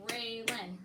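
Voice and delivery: RAYLAN drawn into RAY and LAN; clapped syllables. Shown as a demonstration. Teacher confirming a pupil's answer of two, clapped syllables. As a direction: beat out RAYLAN in two even parts. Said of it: "and clap it".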